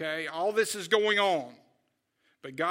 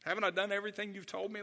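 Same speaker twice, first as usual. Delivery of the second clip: ordinary talk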